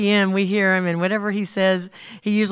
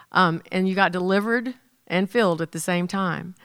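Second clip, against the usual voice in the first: ordinary delivery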